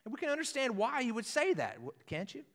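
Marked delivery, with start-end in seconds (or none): none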